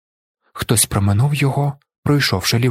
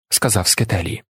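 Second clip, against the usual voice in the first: plain reading voice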